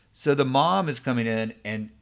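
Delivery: usual speaking voice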